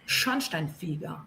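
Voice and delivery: "Schornsteinfeger" slow and low, stressed on "Schorn"; first-syllable stress. Shown as a demonstration. Teacher demonstrating "Schornsteinfeger" to learners, stress on the first syllable. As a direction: lean on the opening syllable of "Schornsteinfeger". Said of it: "colocar a sílaba tônica sempre no começo".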